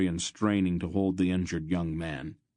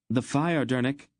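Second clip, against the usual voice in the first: higher, firmer command; ordering; 7 semitones higher, 3 dB louder than usual. A narrator's reading. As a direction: snap out an order. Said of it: "she ordered".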